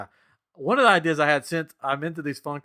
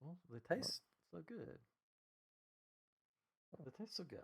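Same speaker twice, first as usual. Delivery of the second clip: very soft, small higher voice; sheepish mock confession; 5 semitones higher, 19 dB quieter than usual. Collector told to stop mouthing Funko Pops, tiny mock-guilty voice. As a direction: mumble it under your breath, playing guilty.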